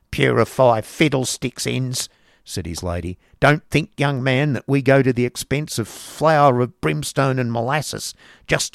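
loud, cross schoolmaster's wife; Mrs Squeers grumbling. Acted. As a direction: grumble at the cupboard. Mrs Squeers lectures Nicholas.

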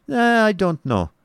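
high, slow hesitation; doubtful Baron. Acted.

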